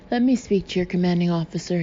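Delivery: usual speaking voice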